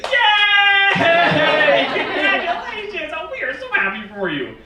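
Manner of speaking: high, loud, drawn-out celebratory yay; gushing congratulations; congratulatory cheer. Shown as a demonstration. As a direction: cheer as if thrilled at pregnancy news. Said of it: "only one proper way to respond".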